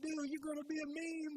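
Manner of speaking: high, laughing through the quote; laughing chief. Retold or imitated. Chief telling him he'll be a meme, high and laughing. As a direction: giggle out the chief's punchline. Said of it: "He says".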